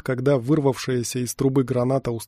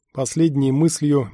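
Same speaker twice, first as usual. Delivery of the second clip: ordinary delivery, nothing marked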